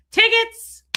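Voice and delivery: high, loud scalper's hawking call; ticket scalper. Retold or imitated. Dad turns around to sell his son's tickets, scalper's call. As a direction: imitate a scalper calling to the crowd. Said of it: "he becomes a scalper and he goes".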